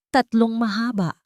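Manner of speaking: ordinary delivery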